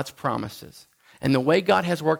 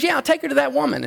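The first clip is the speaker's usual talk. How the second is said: put-on much higher, quick girlish reply; eager sister; 12 semitones higher, 3 dB louder, faster than usual. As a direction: chirp it out like an eager girl. Sister voiced in retold story.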